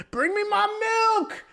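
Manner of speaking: mock scream like a spoiled star calling from bed. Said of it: "he would just scream for his milk".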